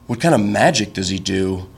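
low, leaning-in question; mock intrigue. Date leans in about the magician, mock-intrigued and low.

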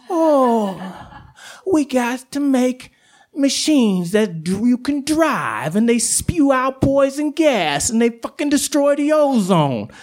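mock the lazy humans; mocking whiny human. Coyote mocking humans' whining.

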